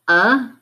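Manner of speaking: a as the short "uh" in bug; isolated vowel sound. Shown as a demonstration. Teacher naming the vowel to swap, a said as short "uh". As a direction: say a alone as "uh". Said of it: "change the vowel sound".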